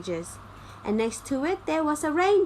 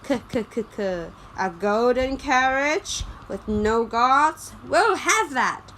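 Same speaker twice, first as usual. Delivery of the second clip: laughing robbers; 4 dB louder than usual. A narrator's reading. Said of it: "Some robbers saw it and laughed".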